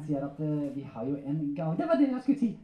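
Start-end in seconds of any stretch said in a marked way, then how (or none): 1.8-2.6 high, cheeky ventriloquist puppet voice; puppet character. Acted. Puppet correcting its handler, high comic voice. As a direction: pipe up higher, like a comic puppet.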